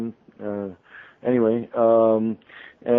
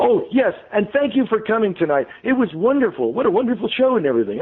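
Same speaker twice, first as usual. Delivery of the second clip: high, rushed, flustered sign-off; flustered stunned babble; 10 semitones higher than usual. Retold or imitated. Host caught off guard on air, flustered high babble. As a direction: gush to cover being caught off guard. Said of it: "I was stunned. I was flabbergasted".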